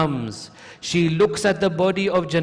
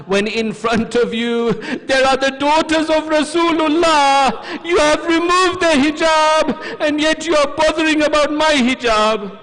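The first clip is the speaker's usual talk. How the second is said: high, loud, impassioned protest; raised preacher's voice at full force; outraged rebuke; 11 semitones higher, 6 dB louder than usual. Retold or imitated. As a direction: cry out in anguished indignation. Zainab's outraged rebuke to Yazid.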